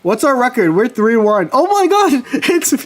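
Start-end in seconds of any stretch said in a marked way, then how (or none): 1.5-2.9 much higher, bursting into laughter; real delighted outburst; squealing laugh at the score; excited laughter. Real, not acted. Excited reaction to record.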